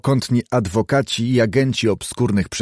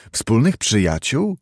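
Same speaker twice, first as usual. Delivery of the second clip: usual speaking voice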